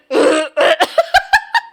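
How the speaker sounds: bitter little laugh; real laughter; choked laugh. Real, not acted. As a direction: laugh at the misery. Soap in mouth.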